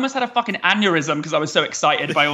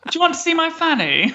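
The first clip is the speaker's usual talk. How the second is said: put-on much higher little-girl voice for the film line; high mock northern girl; Billy Elliot girl impression; 12 semitones higher than usual. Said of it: "in Billy Elliot where she goes".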